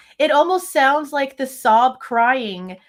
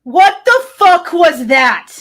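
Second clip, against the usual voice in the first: loud, higher, slow stunned shout; shocked outburst; 7 semitones higher, 11 dB louder, slower than usual. Real, not acted. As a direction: yell it out in real shock, drawn out. Shocked by the played sound.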